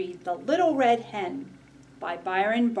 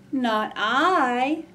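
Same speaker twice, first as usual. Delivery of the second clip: meowing cat voice; 4 semitones higher, 5 dB louder than usual. Acted. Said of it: "meowed the cat".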